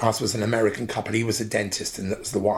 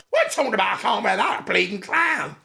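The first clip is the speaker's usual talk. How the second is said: much higher, mock-outraged mother; indignant mum; 11 semitones higher than usual. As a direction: squeal it as the offended mum. Mum refusing the comedy wig.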